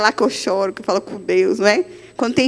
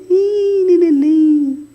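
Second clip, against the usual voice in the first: imitating the translator; 4 semitones higher, 3 dB louder, much slower than usual. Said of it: "é aquelas irmãs que falam bem".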